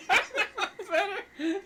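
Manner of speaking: high, very slow laughter; laughing. Real, not acted. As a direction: stretch it out while laughing. Laughing at a pun.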